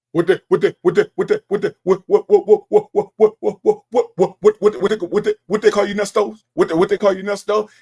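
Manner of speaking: loud, stuttering mock of an excited friend who can't get the words out; mocking a friend too excited to finish his sentence, loud stammer. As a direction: sputter the repeated "what" loudly, tripping over it. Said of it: "one of them people get excited, he can't get them words out".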